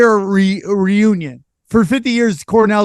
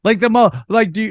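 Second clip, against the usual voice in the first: usual speaking voice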